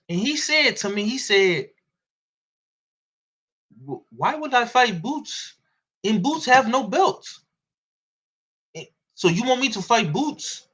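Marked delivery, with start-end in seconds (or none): 3.9-10.7 lightly voiced German accent, like a European boxer; Katz's German accent. Katz telling me off the record about Boots, in a light German accent. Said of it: "In, in his German accent".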